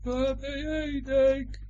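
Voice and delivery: drawn-out high call, heard distantly; faint echo. A narrator's reading. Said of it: "klinkt het vaag terug".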